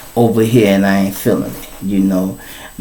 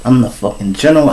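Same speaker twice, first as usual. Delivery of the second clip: ordinary talk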